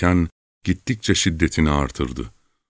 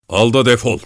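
higher, forceful dismissal; angry order; 6 semitones higher, 4 dB louder than usual. A narrator's reading. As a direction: order him off angrily. Throws money, orders him off.

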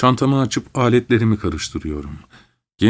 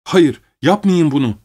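higher, louder pleading cry of a scared maid; frightened cry; 6 semitones higher, 4 dB louder than usual. A narrator's reading. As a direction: beg them to stop, voice lifted in alarm. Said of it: "diye bağırıyor zavallı hizmetçim".

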